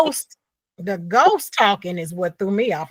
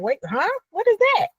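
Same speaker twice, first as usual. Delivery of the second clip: confused surprise; 11 semitones higher than usual. Retold or imitated. Confused by ghost voice.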